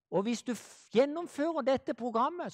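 usual speaking voice

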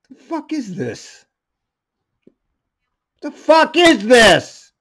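usual speaking voice

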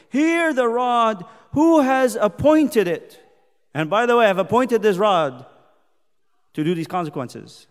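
loud, slow, high opening; stretched words rung out from the pulpit; full-force scripture proclamation. Acted. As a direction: proclaim it like a stern decree.

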